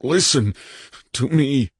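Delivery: high, tense, trembling restraint; barely controlled. A narrator's reading. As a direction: fight to keep the plea under control. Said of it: "visibly trying to keep himself under control".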